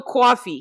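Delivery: put-on "coffee" with a New York accent; mock New York accent on "coffee". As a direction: say "coffee" like a New Yorker, rounded "aw" vowel. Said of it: "New York accent saying coffee".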